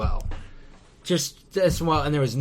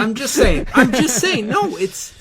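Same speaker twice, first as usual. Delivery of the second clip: loud, high-pitched insistence; insistent protest; 7 semitones higher, 6 dB louder than usual. Real, not acted. Co-host defending his point as his friend laughs, insistent protest. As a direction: talk over the objection, louder and higher. Insisting over co-host's objection.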